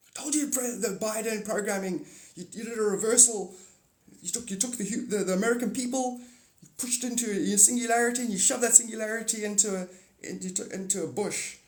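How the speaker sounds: lightly voiced arguing alien.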